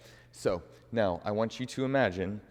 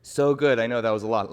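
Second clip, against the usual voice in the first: louder, bright cheering praise; 8 dB louder, faster than usual. Acted. Teacher praising class after a tough sequence, loud. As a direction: cheer them on after the hard part.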